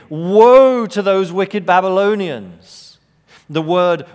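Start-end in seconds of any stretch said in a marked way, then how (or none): 0.1-3.0 loud declaration ending the woe list; loud woe proclamation. Acted. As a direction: pronounce doom on the Babylonians. Third woe against the Babylonians.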